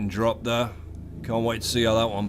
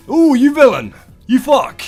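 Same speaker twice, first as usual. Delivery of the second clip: high, loud mock outrage; excited shout; 13 semitones higher, 11 dB louder than usual. Real, not acted. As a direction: yell it in delight at the villain. Reacting to the plot in outrage.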